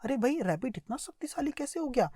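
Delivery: ordinary talk, nothing marked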